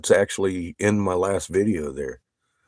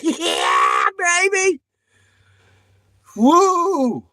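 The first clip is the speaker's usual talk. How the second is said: much higher, louder victory whoop; triumphant yell; 4 dB louder, much slower than usual. Retold or imitated. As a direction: scream it like you won the lottery. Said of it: "Ran all the way up the hill screaming".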